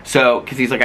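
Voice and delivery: normal talking voice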